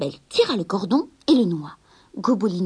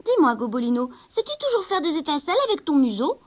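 put-on curious boy; 6 semitones higher, 5 dB louder than usual. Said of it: "demanda le petit garçon".

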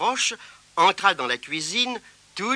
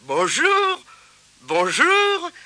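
high, louder cheerful welcome; jolly shopkeeper; 13 semitones higher, 4 dB louder, much slower than usual. Acted. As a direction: greet a customer heartily. Shopkeeper greets the boy.

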